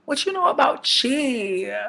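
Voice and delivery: high, loud disbelieving cry; incredulous outburst. Retold or imitated. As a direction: squeal it in mock disbelief. Mocking Liam's talk of chi.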